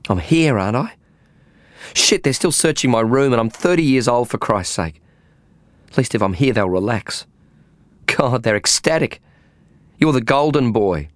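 a frazzled woman blurting out her worries; agitated woman tripping over her own words; agitated young woman. Acted. Faye voiced by narrator.

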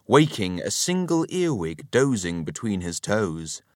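plain reading voice

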